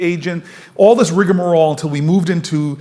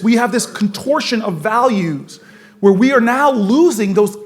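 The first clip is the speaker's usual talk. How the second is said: impassioned speech; 6 semitones higher than usual. Acted. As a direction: build to a preacher's fervor on stage. Speech rising to preaching force.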